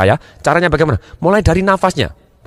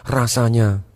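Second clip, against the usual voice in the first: low, slow speech with pauses; unhurried, soft, low demo voice; feeling-type speaker; 5 semitones lower, 4 dB quieter, slower than usual. Acted. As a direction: linger on each phrase, low and soft. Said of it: "dia menggunakan pilihan katanya".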